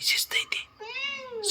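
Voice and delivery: breathy whispered "शिस्त आहे ते" with the voice dropped to nothing. Real, not acted. Owner whispering to the waiting dog, with no voice. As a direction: hush it, like a secret to the dog.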